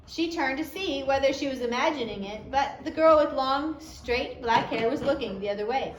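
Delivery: plain reading voice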